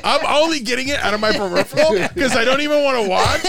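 high, laughing through the words; can barely talk for laughing. Real, not acted. Laughing joke about dollars.

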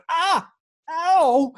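loud, high mock yelp of pain; acted cry of pain. Actor re-enacting his arm being twisted, pained yelps.

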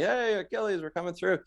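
high-pitched call without much volume; mock holler to clear the way; retold yell. Runner in Achilles shirt clearing the way, mild retold yell. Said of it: "I'm yelling".

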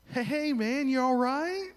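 high, chirpy concerned voice; friendly college kid. Retold or imitated. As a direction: sound overly helpful and sunny. College students checking on him.

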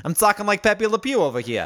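high, hammed-up New York imitation; mock New York accent. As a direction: mimic a New Yorker with broad vowels. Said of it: "You got real New York all of a sudden".

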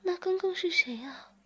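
frightened question from the woman in the story. A narrator's reading. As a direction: ask it in sudden fright. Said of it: "志明马上害怕地说".

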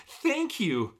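high, bright thank-you; excited cry. A narrator's reading. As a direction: blurt it out, delighted. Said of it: "you cry".

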